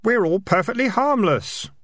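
put-on high boyish voice; high-pitched youngster; innocent kid. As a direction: answer in a high, guileless kid's voice. Kid reassuring the highwayman.

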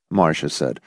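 ordinary delivery, nothing marked

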